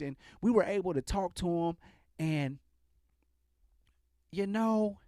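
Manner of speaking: mild mock of a caring press statement; empathetic police captain. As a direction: imitate a captain excusing the shooter gently. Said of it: "this is his demeanor for the most part".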